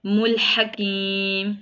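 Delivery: slow, drawn-out Quranic chant with held vowels; Quran recitation. Shown as a demonstration. Teacher reciting the verse for students, slow tajweed chant. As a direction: recite it slowly like tilawat. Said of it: "teacher recites verse in tajweed".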